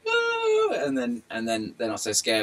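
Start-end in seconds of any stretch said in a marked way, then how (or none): 0.1-0.8 put-on delighted kids' gasp. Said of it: "they're like".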